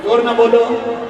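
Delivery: firm, projected demand for a response; insistent call. Real, not acted. Preacher won't go on until they answer, insistent call. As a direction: call out to make them answer up.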